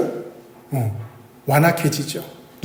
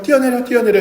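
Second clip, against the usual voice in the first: put-on higher, sing-song coaxing of a little child; coaxing father; 7 semitones higher than usual. Father urging his baby to jump into his arms, coaxing. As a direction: call up to the toddler, urging it to jump.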